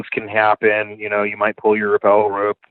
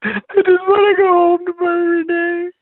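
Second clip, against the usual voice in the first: high, whiny mock sob, much higher pitch; hammed-up crying plea to go home; imitating crying; 3 dB louder than usual. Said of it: "And I'm crying".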